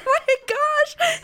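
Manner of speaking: high, slow, squealing oh my gosh; freaking out. Retold or imitated. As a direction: stretch it out in a high fangirl squeal. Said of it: "I was freaking out".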